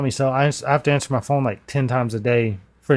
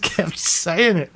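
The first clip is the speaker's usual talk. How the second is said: ordinary talk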